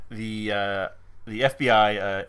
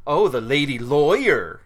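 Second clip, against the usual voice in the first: slow, louder condescending sneer; mock the lady lawyer with disdain; a drawn-out snooty dig; snide tone; 3 semitones higher, 6 dB louder than usual. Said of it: "I don't even know if I can pull off the tone".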